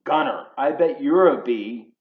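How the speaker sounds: ordinary delivery, nothing marked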